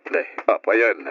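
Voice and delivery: ordinary delivery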